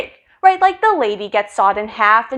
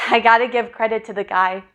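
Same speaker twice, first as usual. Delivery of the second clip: ordinary talk, nothing marked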